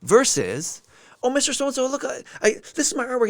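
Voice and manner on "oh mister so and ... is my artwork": put-on high, excited little kid; be the kid proudly showing his drawing; eager kid voice. Kid shows teacher his artwork, high and proud.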